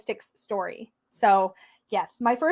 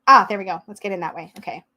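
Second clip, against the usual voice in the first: usual speaking voice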